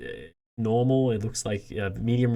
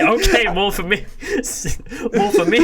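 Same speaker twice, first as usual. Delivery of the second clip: laughing; 7 semitones higher, 4 dB louder than usual. Pretend. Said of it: "I'd be like".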